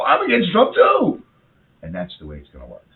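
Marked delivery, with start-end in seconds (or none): 0.0-1.2 much higher and louder, slow mocking voice. Host mocking a protester, high and slow. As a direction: sneer it in a high mock voice. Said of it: "so they're gonna go".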